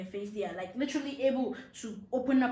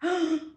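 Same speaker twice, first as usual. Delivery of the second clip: sharp, loud fake gasp of shock; mock gasp; 6 semitones higher, 5 dB louder than usual. Teasing daughter answering mom with a put-on gasp. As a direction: gasp as if caught out. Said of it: "I'll be like".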